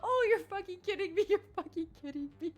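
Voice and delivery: high, loud, cracking up in disbelief; real laughter bursting through the words; laughing disbelief. Real, not acted. Laughing at the bee rule.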